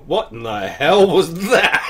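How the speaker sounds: slow, loud baffled question; loud, slow and bewildered; baffled outburst. Retold or imitated. Waking from a weird dream.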